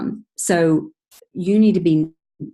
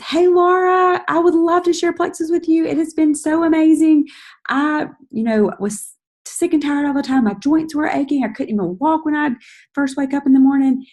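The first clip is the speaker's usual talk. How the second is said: high, loud, gushing testimony; excited voice text; 8 semitones higher, 3 dB louder than usual. Shown as a demonstration. Networker demoing an excited voice text to Laura, high and gushing. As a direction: bubble over with excitement to Laura. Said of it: "Hearing the inflection in the, your voice and the excitement".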